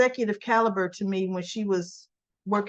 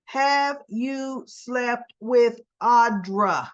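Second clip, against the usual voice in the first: slow, louder point-blank demand; a girlfriend demanding the truth, voice raised; 4 dB louder, much slower than usual. Retold or imitated. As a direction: lean on every word of the accusation. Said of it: "And all her voices raised".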